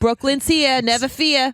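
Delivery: mock Brooklyn street-kid accent; Newsies accent. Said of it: "thinking about the Newsies accents".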